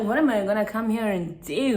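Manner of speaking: loud mocking disbelief; incredulous yell. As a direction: throw the question back at him, indignant. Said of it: "I'm like".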